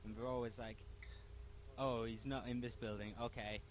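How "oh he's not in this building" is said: ordinary talk, nothing marked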